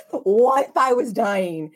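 mock your overdramatic teenager; dramatic daughter. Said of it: "She is my dramatic daughter".

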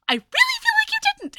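very high, loud, fast mock yell; shrieky acted-out protest; retold yell. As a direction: yell it in exasperation, acting her out. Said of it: "like, she yells".

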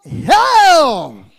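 long, high shouted proclamation; shouted hail. Acted. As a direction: bellow the greeting, stretching each word. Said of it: "What did he say?".

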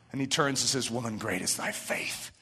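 very low, soft voice; hushed reverence. Retold or imitated. Preacher voicing Jesus answering the woman, hushed reverence. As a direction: drop right down, gentle and grave.

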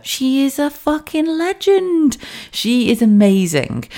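high, slow gush of awe; gushing admiration. Real, not acted. Praising the Viking heroine, slow and high with awe. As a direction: drag it out high in adoration. Gushing about her hero.

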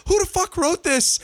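high, loud disbelieving cry; exasperated outburst. Retold or imitated. Moviegoer mocking the script, exasperated high outcry. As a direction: throw up your hands at the writers. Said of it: "And you're like".

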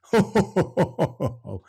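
high, bubbling chuckle at the letter; a short high laugh after reading the letter; amused chuckle. Real, not acted. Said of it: "It's a funny one".

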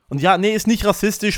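normal talking voice

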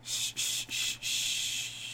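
soft hushing; shushing. Acted. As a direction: hush them gently. Hushing the partner.

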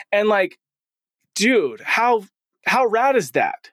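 delighted dad gushing; excited. Real, not acted. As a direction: pitch jumps up high, thrilled. Delighted about daughter's initiative.

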